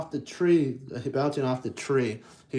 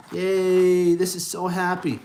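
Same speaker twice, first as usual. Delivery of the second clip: excited cheer; 5 semitones higher, 6 dB louder than usual. Real, not acted. Reader cheering for the story.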